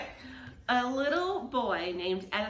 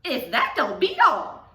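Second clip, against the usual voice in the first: louder amazed deputy; louder astonished blurt; blabbering; 4 semitones higher, 7 dB louder than usual. Acted. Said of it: "Deputy Bob blabbered".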